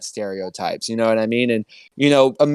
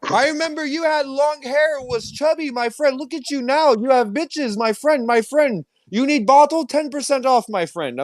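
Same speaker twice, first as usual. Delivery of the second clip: much higher mock accented friendly greeting; imitating shopkeeper; 14 semitones higher than usual. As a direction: greet him like the old shopkeeper. Liquor store owner voiced.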